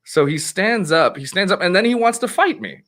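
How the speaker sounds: usual speaking voice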